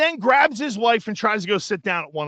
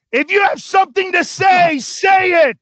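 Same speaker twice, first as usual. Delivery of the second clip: high, loud in-your-face shout; confrontational yell; 8 semitones higher, 4 dB louder than usual. Retold or imitated. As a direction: get in her face and shout. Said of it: "gotten right in her face".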